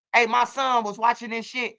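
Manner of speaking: usual speaking voice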